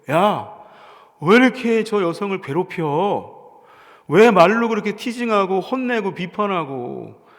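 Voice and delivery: loud, slow, firm rebuke; rebuking Jesus. Retold or imitated. Jesus rebuking the disciples for troubling the woman, loud and slow. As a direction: defend the woman, stop them sharply. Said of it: "우리의 일상으로 고치면 이런 거예요".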